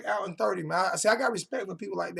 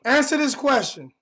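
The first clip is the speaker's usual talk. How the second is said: loud demand; 6 semitones higher, 5 dB louder than usual. Real, not acted. Demanding an answer from viewer.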